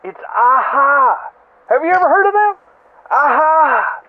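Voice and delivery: ordinary talk, nothing marked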